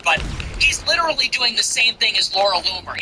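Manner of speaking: normal talking voice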